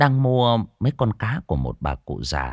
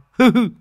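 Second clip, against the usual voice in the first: high, loud short laugh; loud mocking chuckle; gloating laugh; 8 dB louder than usual. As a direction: laugh in her face. Tý laughs at the woman.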